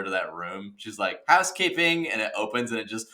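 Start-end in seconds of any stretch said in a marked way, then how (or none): 1.3-2.1 high little door-knock call; housekeeping lady. Retold or imitated. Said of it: "when that lady opens the door".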